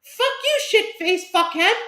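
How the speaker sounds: high squeaky alien voice; little alien. Retold or imitated. Retelling the video game alien's insult, high squeaky creature voice. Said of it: "And he's like".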